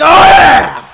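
big, loud drawn-out shout; high, loud mock bravado; wrestler's gloating roar. Voices a wrestler revelling.

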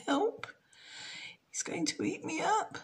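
high, hushed frightened thoughts; a small girl's panicked inner voice; scared little girl. Acted. Jenny thinks in fright at the crocodile, high and hushed. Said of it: "Jenny thought".

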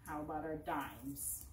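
ordinary talk, nothing marked

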